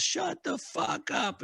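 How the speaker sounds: high, slow retold yell at the new guy; mock crowd yell. Mimicking the whole team yelling at the new guy, high and slow. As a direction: yell it like a couple hundred angry dudes. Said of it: "everyone yells".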